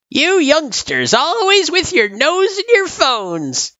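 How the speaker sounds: put-on old turtle teasing, high and slow. A narrator's reading. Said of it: "he teased".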